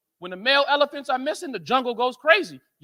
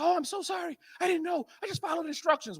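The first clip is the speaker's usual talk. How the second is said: apologetic nurse; 4 semitones higher, 8 dB quieter than usual. Retold or imitated. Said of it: "She said".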